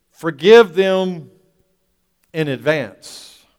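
slow, loud preached command; preaching at full force. Acted. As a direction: hammer the command out slowly.

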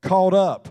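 preacher hammering "caught up" for the congregation; emphasis on caught up. Shown as a demonstration. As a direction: land hard on "caught up". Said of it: "Let me just kinda emphasize that word".

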